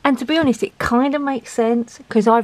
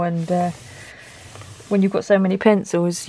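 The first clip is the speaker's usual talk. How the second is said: normal talking voice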